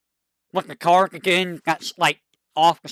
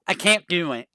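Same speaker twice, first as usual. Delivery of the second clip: ordinary talk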